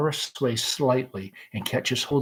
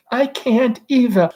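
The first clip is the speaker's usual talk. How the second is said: much higher, a touch of despair; despairingly; 10 semitones higher, 5 dB louder than usual. Acted. Laura refuses the door, despairing. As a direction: give up hope. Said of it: "Laura despairingly".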